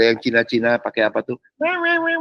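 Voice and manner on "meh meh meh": high, bleating fake sobs; mock wailing. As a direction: wail in mockery of noisy mourners. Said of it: "nangis-nangis gitu".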